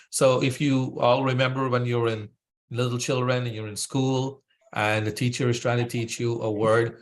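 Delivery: usual speaking voice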